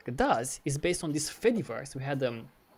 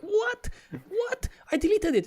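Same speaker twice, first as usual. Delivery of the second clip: much higher, louder incredulous squeal; retold outraged disbelief; 4 dB louder than usual. User protesting to Google over deleted data, outraged disbelief. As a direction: act out stunned protest at being billed.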